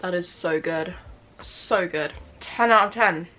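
chewing while talking; eating a burger; mouth full of food. Real, not acted. Seen 1: bitten burger in hand, cheeks puffed, chewing after bite.

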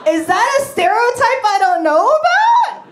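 high, loud, slow wide-eyed wonder; mock amazement. Replying to the guy, hammed-up astonishment. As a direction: gasp it out as if fascinated. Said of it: "I'm like".

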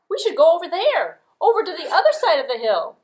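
high, loud eager goat. Acted. Goat urging brothers to cross, high eager goat voice. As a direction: call out the plan like a keen young goat.

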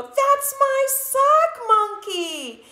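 high, slow, indignant yell. A narrator's reading. Scipito yells at the green visitors, high and outraged. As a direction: cry out to claim the toy. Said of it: "Yelled Scipito".

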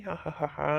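soft sly villain laugh. Acted. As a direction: chuckle like a sneaky thief. Villainous laugh after the song. (ಮೆಲ್ಲನೆ ಕುತಂತ್ರದ ಖಳನಗು)

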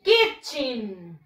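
Kitchen with a short i and a "ch" sound; model pronunciation. Shown as a demonstration. Teacher showing how Kitchen is pronounced, clear. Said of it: "Se pronuncia de esta manera".

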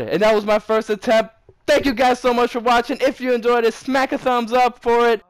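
loud, high excited outro; hyped shouting. Real, not acted. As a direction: stay pumped through the outro. Celebrating, then outro still hyped.

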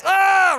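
mock scream at the stink; mock shout. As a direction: blast out a yell of disgust. Emotional BO blast.

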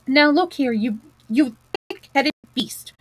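stammering, indignant telling-off; really angry. A narrator's reading. Rat turns on thick-headed mole, really angry. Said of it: "really angry".

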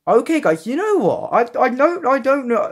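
high, loud, excited outburst. Real, not acted. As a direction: burst out, thrilled and surprised. Surprised by how head looks.

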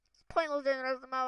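normal talking voice

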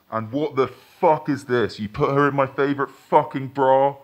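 furious accusation, rising; angry. Acted. Finds boyfriend cheating.

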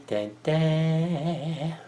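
normal talking voice

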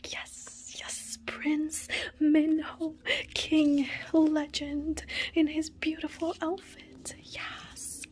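breathless high squeal fading into whisper; overwhelmed fangirling. Real, not acted. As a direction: squeal each title in hushed, drawn-out rapture. Fangirling over pulled photo card.

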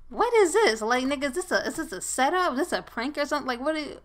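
high, hushed disbelief; bewildered. Real, not acted. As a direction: question it softly in a high, baffled voice. Bewildered at companion's sudden odd talk.

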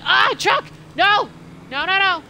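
high, loud panicked no's; panicked scream. Real, not acted. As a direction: panic at the oncoming truck. Truck coming in game.

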